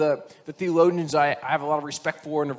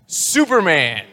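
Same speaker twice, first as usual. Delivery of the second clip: loud, drawn-out superhero name; proud punchline; 8 dB louder, much slower than usual. Acted. Little boy answers the principal, proudly drawn out. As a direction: stretch it out like a hero's announcement.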